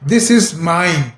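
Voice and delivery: this is mine drawn out very slowly; slow dictation of the example sentence; slow, spelled-out demo. Shown as a demonstration. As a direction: lay out each word for the class. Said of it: "آپ کو لکھنا پڑے گا".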